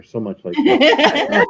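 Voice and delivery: high, slow, laughing in disbelief. Real, not acted. Reacting to Carla sounding like her aunt, laughing. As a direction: marvel at it through a laugh. Amazed at caller's accent.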